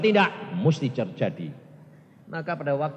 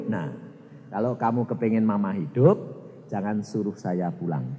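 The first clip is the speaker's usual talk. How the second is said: ordinary delivery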